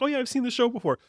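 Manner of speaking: high-pitched mocking quote; mimicked audience. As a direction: pitch it way up, imitating other theatergoers. Mimics blasé audience members losing it.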